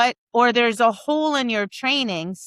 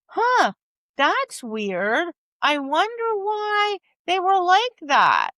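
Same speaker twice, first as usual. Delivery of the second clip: mock puzzlement; 7 semitones higher, slower than usual. Mock puzzlement after leaving ring.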